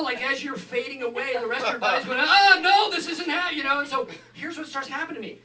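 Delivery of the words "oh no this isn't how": panicked. Retold or imitated. Body panicking, louder.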